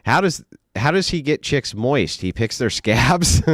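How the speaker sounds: usual speaking voice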